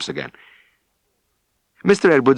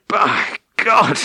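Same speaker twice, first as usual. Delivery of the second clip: higher, strained mock grunt of an exhausted farmer; a tired farmer's heavy oath; 9 semitones higher than usual. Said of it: "he grunted".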